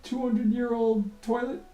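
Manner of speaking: mock rant. Angry janitor ranting to coworker Sharon, hammed-up. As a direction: vent like a fed-up janitor at a coworker. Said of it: "I'm like".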